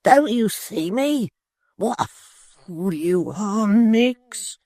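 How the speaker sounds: pleading to be recognised; Tappertit's voice. Acted. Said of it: "rejoined Mister Tappertit".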